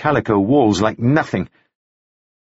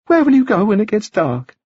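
anxious woman voice; 11 semitones higher than usual. Acted. Margaret's voice continues.

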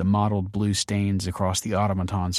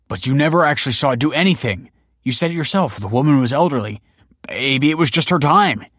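higher, louder, quick argument; heated protest; 6 semitones higher, 6 dB louder, faster than usual. A narrator's reading. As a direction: argue back urgently, voice raised. Arguing in the machine's defence.

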